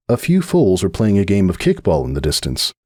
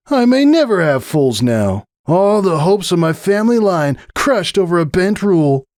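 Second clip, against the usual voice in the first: lightly hammed-up lament; a touch of playful melodrama; mock tragic stallion; 11 semitones higher than usual. Stallion joking to his marefriend about a sports injury.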